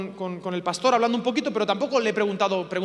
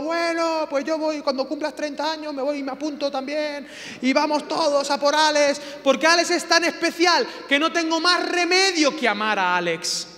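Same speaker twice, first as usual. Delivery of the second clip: higher, lightly hammed-up eager voice; mock eager Holy Spirit; 6 semitones higher, 4 dB louder than usual. The Holy Spirit joining the rescue, mock eager. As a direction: jump in cheerfully. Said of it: "Y el Espíritu Santo".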